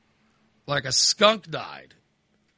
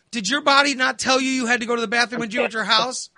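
fast incredulous scolding; 6 semitones higher, faster than usual. Retold or imitated. Son scolding mother about her bathroom habits, fast high incredulous. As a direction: rattle it off at her.

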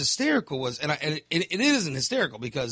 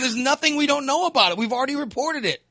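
raised mock reply; 8 semitones higher than usual. Media answering Trump's complaint, raised mock reply. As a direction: fire back at Trump in a raised voice. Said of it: "They said".

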